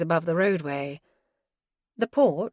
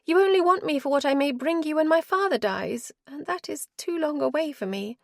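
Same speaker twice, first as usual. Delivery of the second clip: higher-voiced wife, cold and weary; wife's voice; 9 semitones higher than usual. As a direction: she refuses him, tired and final.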